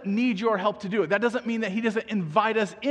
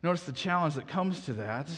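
ordinary talk

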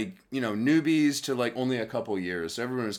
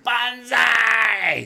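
put-on a high, loud banzai scream like drunk salarymen; retold scream; 12 semitones higher, 8 dB louder than usual. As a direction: yell Banzai as loud as you can.

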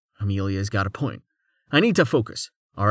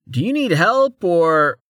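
sarcastic; 7 semitones higher, 4 dB louder, slower than usual. A narrator's reading. Amelia sarcastic.

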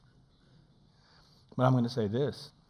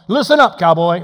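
stern call; 4 semitones higher, 12 dB louder than usual. Acted. God scolding a man.

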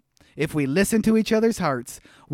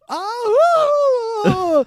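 high cartoon yelp; Goofy scream; 6 dB louder than usual. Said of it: "Can you do the Goofy scream?".

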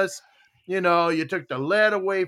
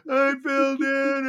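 put-on whiny kid; 8 semitones higher, much slower than usual. Kid crying voice in retold scene.

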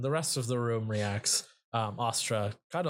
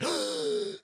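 sharp high gasp for air; huge mock gasp. The stone head gasps as it's pulled from the bag, a huge high gasp. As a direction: suck in a big startled gasp. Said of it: "He lets out, like, a large".

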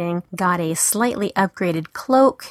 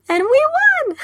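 high, bursting victory cry; thrilled; faster than usual. Real, not acted. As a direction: burst out with the win. Victory at raid climax.

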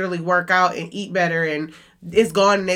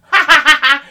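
big, loud, high hammed-up laugh; exaggerated ha ha ha; dramatic laugh; 6 semitones higher, 8 dB louder than usual. Shown as a demonstration. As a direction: show off your dramatic cackle. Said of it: "I laugh so dramatically and I always go".

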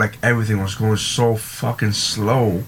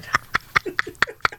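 high laugh. Real, not acted. Laughing at own cookie story.

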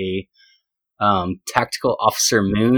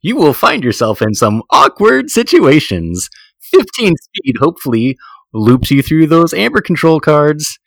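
nineties game show host, pitched up; 3 semitones higher than usual. Acted. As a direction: hype it like a Price is Right announcer. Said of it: "Sir Weird Salesman Voice".

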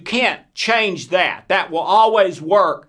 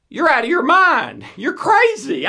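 incredulous retort; 7 semitones higher than usual. Retold or imitated. People dismissing the fear as crazy, high incredulous retort. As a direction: pitch jumps high in mock disbelief. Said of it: "most of them say".

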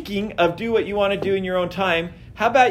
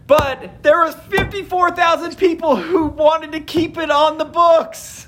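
incredulous; 10 semitones higher, slower than usual. Real, not acted. Outraged disbelief at vote.

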